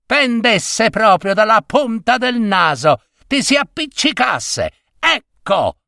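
high-pitched, exasperated curse; irritated cursing. A narrator's reading. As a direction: wish it on a nagging wife with spite. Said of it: "Seccato e irritato da questi rimproveri". (maledizione esasperata, tono acuto)

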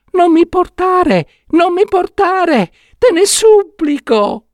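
a little trapped prince begging for help; tiny pleading prince. Acted. Enchanted prince in the box begging Saetta, tiny high pleading voice. Prince tells his story.

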